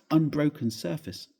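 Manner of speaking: ordinary delivery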